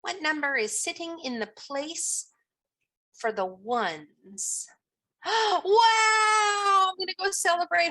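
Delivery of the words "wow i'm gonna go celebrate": loud high gasp and cheer; excited cheer. Real, not acted. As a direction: burst out celebrating. Teacher celebrating class success.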